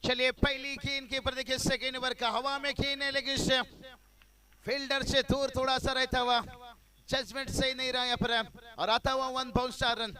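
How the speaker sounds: loud, high play-by-play of a four; excited cricket commentary. Acted. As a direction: shout the shot as it flies. Live boundary call.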